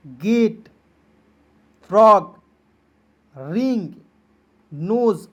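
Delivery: loud, slow word-by-word readout; teacher leading a revision list. Shown as a demonstration. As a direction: read each word out with a pause after it. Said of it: "आइए एक बार पढ़ते हैं".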